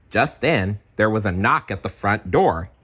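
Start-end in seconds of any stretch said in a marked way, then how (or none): none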